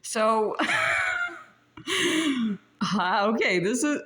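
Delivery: breaking up into shrieking laughs; real laughter. Real, not acted. Psychic cracking up at her own rant, laughing loudly. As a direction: laugh it out, high and loud.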